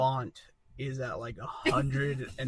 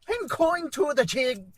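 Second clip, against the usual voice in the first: high, slow Irish lilt; hammed-up Irish accent; sing-song comic Irish, pitched right up; 11 semitones higher, 3 dB louder than usual. Shown as a demonstration. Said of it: "Can I do an Irish accent?".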